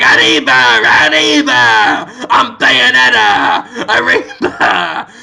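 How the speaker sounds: loud, slow, laughing shouts of arriba; half-laughing hammed-up yell; mock Mexican-style cheer. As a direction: whoop it like a goofy hero. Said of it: "And he's going".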